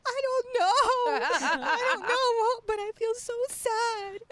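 high, laughing fake sob; mock crying. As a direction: wail it out through a laugh, half-joking. Retold crying call home.

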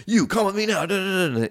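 high-pitched mock bossy order; pissed off. Angry dude orders the lost dealer to follow him, mock pissed off. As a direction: imitate an irritated man ordering someone around. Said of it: "He seems, like, pissed off".

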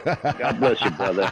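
high, laughing "God bless you" like a preacher. Pretend. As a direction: bless him like a revival preacher. Mock preacher blessing a caller.

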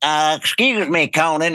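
a lightly voiced pompous interrupter; interrupting character voice. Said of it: "character that would be like".

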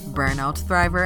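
normal talking voice

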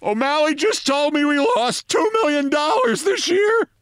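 very high, frantic outcry; near shout. A narrator's reading. Manager telling his colleague the news, frantic near shout. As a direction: blurt it out way up high. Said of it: "the rest of the sentence he fairly shouted".

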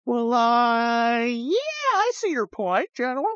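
high, slow, timid giving in; high and hesitant, meekly agreeing; meek backing down. Retold or imitated. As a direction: cave in meekly to the general. Foreign officials caving to general.

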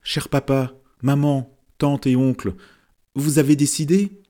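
a hint of a high child's voice; little girl asking aloud. A narrator's reading. Girl asking her parents to decide, lightly voiced child. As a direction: call out to the grown-ups like a small girl. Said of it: "Dadine demanda à haute voix".

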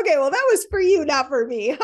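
laughing retort. Retold or imitated. Mother retells her own reply to her daughter, laughing. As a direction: toss it off, laughing at yourself. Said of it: "tell her something similar".

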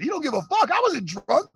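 ordinary talk, nothing marked

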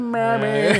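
mock [name] mammy. Shown as a demonstration. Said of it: "I almost went".

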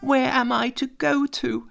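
very high, pleading tearful monkey; high tearful question. A narrator's reading. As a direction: plead through tears. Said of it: "Monkey burst into tears".

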